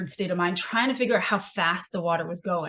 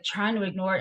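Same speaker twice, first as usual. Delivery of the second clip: usual speaking voice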